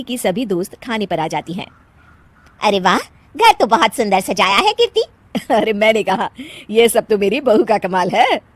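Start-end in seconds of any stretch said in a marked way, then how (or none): none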